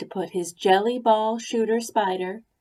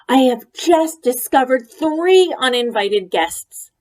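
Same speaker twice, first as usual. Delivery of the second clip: loud raised announcement; indignant announcement; 5 semitones higher, 10 dB louder than usual. A narrator's reading. Ruby announcing the uninvited guests to Grandma, indignant. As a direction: announce the intruders, scandalized. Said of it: "said Ruby".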